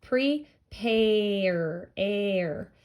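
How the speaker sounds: "Prepare" drawn out as pre... paaayr, then "air"; stretched long A. Shown as a demonstration. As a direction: stretch the vowel in pare and repeat it as "air". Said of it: "I hear a long A sound".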